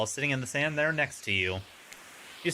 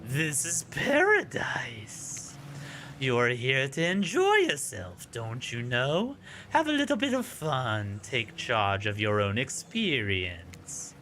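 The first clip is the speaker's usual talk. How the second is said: eerie ocean voice; 4 semitones lower, slower than usual. Acted. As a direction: lure them in like a whispering sea. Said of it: "that voice again".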